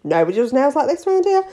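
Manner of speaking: high, thrilled, breathless rave; gushing client. Retold or imitated. Hooked client raving about her nails at bingo, high and thrilled. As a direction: rave about your nails to the whole town. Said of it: "they'd be like".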